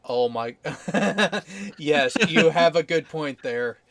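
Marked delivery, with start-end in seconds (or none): none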